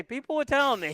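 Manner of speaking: high-pitched mimicry of an aggrieved friend; imitating a complainer. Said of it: "No, he's gonna say".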